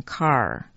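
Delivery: Kar with a long open "ah"; character read as Kar. Shown as a demonstration. Teacher showing how 劫 is read, pronunciation demo. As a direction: demonstrate Kar as one drawn-out syllable. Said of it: "劫这个字念成".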